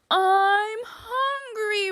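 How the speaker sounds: drawn-out, moaning hungry bear; slow wailing bear cry; bear roar. Acted. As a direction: groan it out like a starving bear. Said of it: "roars Bear".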